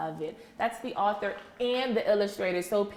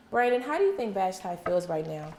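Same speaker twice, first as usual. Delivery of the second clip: ordinary delivery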